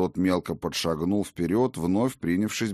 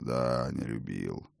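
slower, lower, drawn-out echo; slow musing old king; 8 semitones lower, 7 dB quieter, slower than usual. Acted. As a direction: repeat it slowly to yourself. Odin repeats slowly.

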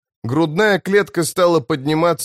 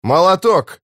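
raised, higher call over the shoulder; 5 semitones higher, 4 dB louder than usual. A narrator's reading. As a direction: shout for the healer, not at full volume. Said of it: "крикнул он через плечо".

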